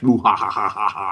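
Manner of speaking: low evil villain laugh. Pretend. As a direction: cackle like a Bond villain. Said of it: "he's twirling his mustache".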